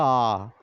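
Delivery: "आ" held as a long open ah; long vowel sound. Shown as a demonstration. Teacher showing the letter's sound, "आ" held long. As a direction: stretch "आ" into one steady vowel. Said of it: "इन आवाज़ों को".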